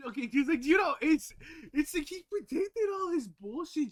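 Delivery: loud, high and worked up; getting fired up, almost yelling; excited rant. Real, not acted. Worked-up rant about Nostradamus.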